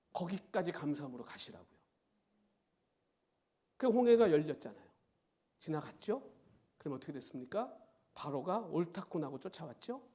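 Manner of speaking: usual speaking voice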